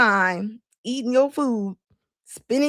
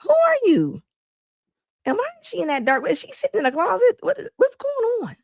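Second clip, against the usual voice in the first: incredulous; 9 semitones higher, faster than usual. Real, not acted. Mocking her dark video.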